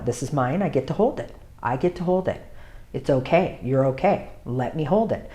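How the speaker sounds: soft, gentle and steady; quiet reassuring groomer voice; calm reassurance. Shown as a demonstration. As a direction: soothe the puppy while holding firm. Groomer calmly talking to puppy.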